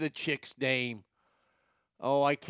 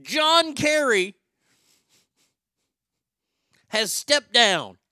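loud, high, drawn-out announcement; mock-triumphant announcer shout; grand proclamation; 7 semitones higher, 10 dB louder, slower than usual. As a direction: proclaim it slowly like a town crier. Mock proclamation after celebratory song.

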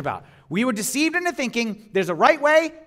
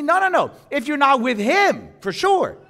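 normal talking voice

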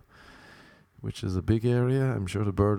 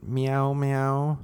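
mock cat meow; 4 semitones higher than usual. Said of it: "it said".

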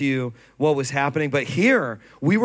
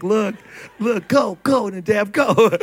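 higher, louder excited cheering; 5 semitones higher, 5 dB louder, slower than usual. Retold or imitated. Proud pastor filming Nadab, cheering him on excitedly. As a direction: hype him up like a proud dad.

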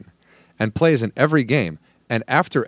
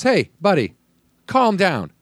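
retold intervention; 7 semitones higher, slower than usual. Buddy intervenes in a retold argument.

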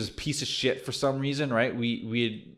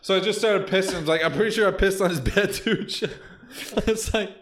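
laughing; 7 semitones higher than usual. Real, not acted. Still laughing about the prank.

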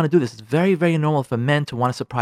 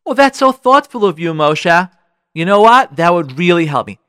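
touched, appreciative and louder; louder, lifted "oh, how thoughtful" delivery; grateful wife; 7 dB louder than usual. Acted. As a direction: thank him with real appreciation. Grateful wife accepts the offer.

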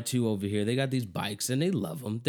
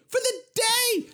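FOR the day flung up very high; stressed for the day; 3 dB louder than usual. Retold or imitated. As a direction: hammer home that it's only for the day. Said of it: "done for the day".

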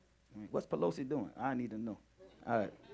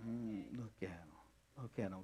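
hushed; 8 semitones lower, 19 dB quieter than usual. Real, not acted. Hushed watching the children.

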